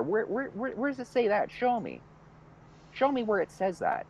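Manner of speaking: high, insistent stammering demand; indignant challenge. Retold or imitated. Parent challenging a cop on the law, indignant.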